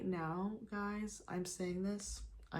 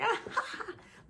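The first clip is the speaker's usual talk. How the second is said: high loud cackling laugh; demoing her loud late-night cackle laugh; 4 dB louder than usual. Shown as a demonstration. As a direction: let out a big cackle. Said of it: "my cackle laugh".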